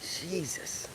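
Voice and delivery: breathless whisper of awe; awed whisper. Real, not acted. Staring at the huge iguana, a stunned whisper. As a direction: barely breathe it out in amazement.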